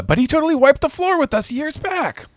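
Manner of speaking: very high, panicked protest; hammed-up frightened squeal; mock panic. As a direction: protest shrilly, close to hysterics. Acted panicked protest by Usopp.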